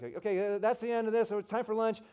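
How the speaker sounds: loud, high announcement to a crowd; Rick Warren. Retold or imitated. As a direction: dismiss a whole room for lunch from the stage. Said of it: "Rick Warren's like".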